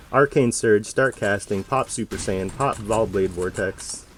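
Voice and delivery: loud, hyped gameplay commentary; excited callouts. Real, not acted. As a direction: call out each move mid-fight. Loud live callouts during boss fight.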